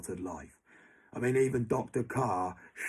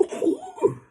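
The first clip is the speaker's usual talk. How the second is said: sharp gasp; 7 dB louder than usual. Real, not acted. Gasping mid-rant.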